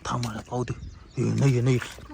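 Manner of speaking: low, soft murmur; cautious hush. Acted. Man checks whether the witch is gone, cautious hush. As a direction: keep it down while checking.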